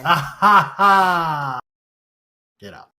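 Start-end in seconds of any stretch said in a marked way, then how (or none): 0.0-1.6 loud, high, spaced-out "ha" laugh; triumphant mock laugh. Player gloating after shotgun kill, triumphant laugh. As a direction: gloat with each "ha" held out.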